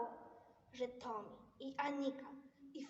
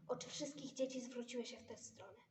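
plain reading voice

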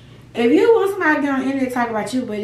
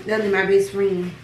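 usual speaking voice

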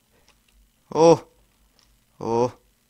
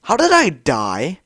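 puzzled question; 5 semitones higher, 8 dB louder than usual. Real, not acted. Gamer baffled by sudden death.

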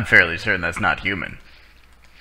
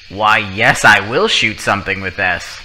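megalomaniac rant; 3 semitones higher, 6 dB louder than usual. Pretend. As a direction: boast like a power-mad villain. Holding a gun, grandiose.

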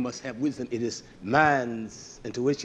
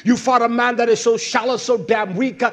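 driving, relentless sermon cadence; fiery preaching; 5 semitones higher, 6 dB louder than usual. Real, not acted. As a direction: pile up the accusations in rhythmic bursts. Preaches at full force.

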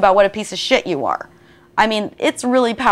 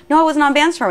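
higher, insistent denial; mock insistent no, pitched up; 7 semitones higher than usual. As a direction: push back hard, no. Acted denial in a rap.

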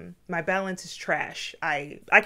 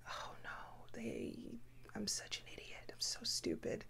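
breathy self-scolding whisper, much softer; panicked inner whisper; 3 semitones higher, 19 dB quieter than usual. Retold or imitated. Child berating herself after spilling beef, frightened whisper. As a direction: hiss it to yourself in panic.

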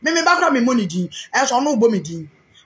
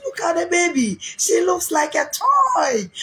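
mock cooing visitor; 7 semitones higher, slower than usual. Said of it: "Ɔse".

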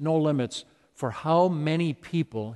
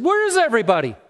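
higher, louder, searching questions; Jesus asking; 7 semitones higher, 3 dB louder than usual. Retold or imitated. Jesus asks where the other nine lepers are, raised and searching. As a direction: call out for the missing nine. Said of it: "Jesus said".

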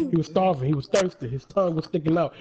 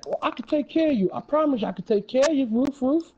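put-on little boy; 7 semitones higher than usual. Boy voiced in story.

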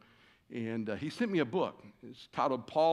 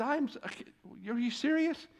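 high, incredulous protest; can't believe what he's hearing; mock disbelief; 11 semitones higher than usual. Retold disbelief on phone.

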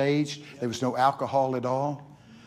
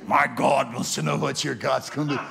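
a hint of an eerie possessed voice, lightly done; spooky voice; 4 dB louder than usual. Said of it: "here's what he said in a spooky voice".

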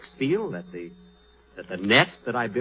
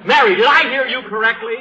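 indignant disbelief; 8 semitones higher, 7 dB louder than usual. Acted. Reacting to shocking news.

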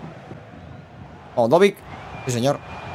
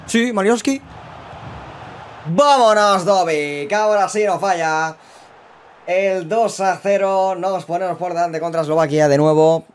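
breakneck goal commentary; 6 semitones higher than usual. Real, not acted. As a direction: shout the goal live. Second goal scored. (narración de gol eufórica)

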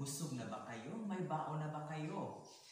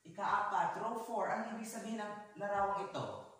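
high, loud question to a row; projected classroom question; calling out; 8 semitones higher, 6 dB louder than usual. Real, not acted. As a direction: call on a row of pupils across the room. Teacher calls on row four.

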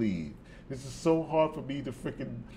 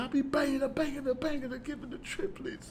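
high squeaky echo, trailing softer; amazed mock quoting; 13 semitones higher, 4 dB quieter, faster than usual. Reactor relives the rapper's triplets line, high amazed squeak. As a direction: quote it up high in disbelief.

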